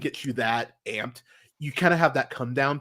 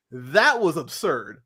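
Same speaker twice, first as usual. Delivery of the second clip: ordinary delivery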